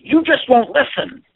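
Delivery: loud angry complaint, pitch up. Shown as a demonstration. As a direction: yell it at them, drawn out. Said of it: "But if they say it loud".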